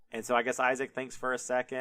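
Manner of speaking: plain reading voice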